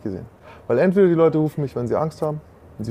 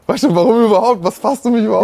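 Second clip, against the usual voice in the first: put-on old self, indignant; 6 semitones higher, 5 dB louder than usual. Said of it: "mein altes Ich hätte jetzt gesagt".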